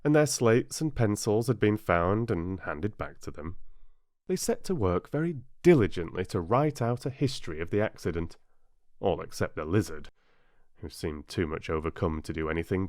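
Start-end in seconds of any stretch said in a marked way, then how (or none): none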